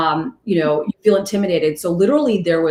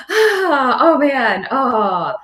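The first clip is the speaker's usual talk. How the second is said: high shuddery groan, easing down; getting chills; 6 semitones higher, much slower than usual. Real, not acted. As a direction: exhale and groan as the memory gives you chills. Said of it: "I'm still getting chills".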